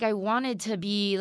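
usual speaking voice

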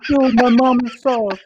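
high, loud hammed-up Italian cry; fake Italian accent. Podcaster mocking an overacting Italian actor in a zombie movie, fake Italian accent. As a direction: wail it like a cartoon Italian. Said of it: "fake Italian lines".

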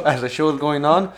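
ordinary delivery

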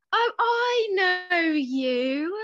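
high, slow, drawn-out exclamation; exclaimed recognition. A narrator's reading. Lucy suddenly recognizes the famous boy, exclaiming. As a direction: stretch it out as you recognize them. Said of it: "Lucy exclaimed".

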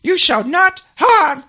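high, loud, slow house-elf; Dobby. Acted. Elf protecting the boy wizard, high loud impersonation. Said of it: "That's a good impersonation there of Dobby".

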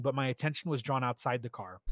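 usual speaking voice

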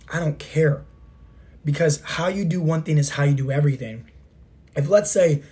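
usual speaking voice

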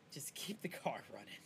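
hushed conspiratorial whisper. Pretend. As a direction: whisper it like a getaway tip. Joking aside to the guys.

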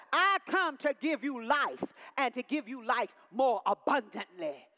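loud, building call and response; preaching at full force. Acted. Preacher drives the congregation toward the Messiah, loud preaching.

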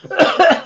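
sudden choking cough. Real, not acted. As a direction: cough as if something went down wrong. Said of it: "Eu me engasguei".

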